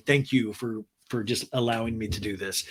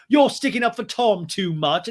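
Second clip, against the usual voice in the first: loud, higher mock accusation; hammed-up complaint, pitched up; James; 6 semitones higher, 7 dB louder than usual. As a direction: imitate an aggrieved man accusing. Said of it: "And James is like".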